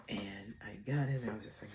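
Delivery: ordinary talk, nothing marked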